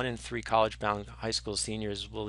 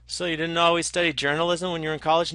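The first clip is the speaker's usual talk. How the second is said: quick, higher and louder acted question; Bill Murray's date question; 6 semitones higher, 6 dB louder, faster than usual. Lecturer acting out Bill Murray quizzing his date, fast and brighter. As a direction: rattle off the small talk. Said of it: "he goes".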